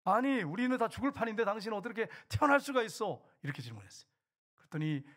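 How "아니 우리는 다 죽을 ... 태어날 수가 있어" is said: retold indignant protest, pitched up; angry wife. Wife confronting her calm husband after the storm, angrily. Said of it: "화가 나가지고".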